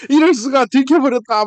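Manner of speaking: high, loud melodramatic lament; hammed-up horror at being found out; mock dismay. Boy found out with his perfect score, melodramatic dismay. 남자애가 들켜서 과장되게 탄식.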